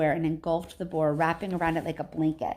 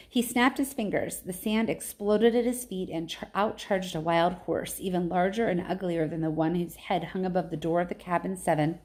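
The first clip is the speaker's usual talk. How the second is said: usual speaking voice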